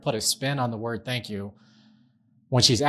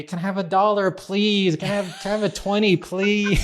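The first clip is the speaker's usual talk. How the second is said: granddaughter's pleading voice; 8 semitones higher than usual. Retold or imitated. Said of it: "Next is gonna be".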